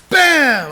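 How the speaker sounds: explosive, high-volume impact word; loud punch noise. Retold or imitated. Storyteller acting out punching his friend, explosive shout. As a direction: burst it out like a blow landing.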